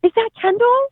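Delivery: high, gushing surprise at spotting her; excited fan. Retold or imitated. Lady in Starbucks gasping at a recognized mom. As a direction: squeal like a starstruck fan. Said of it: "this lady's like".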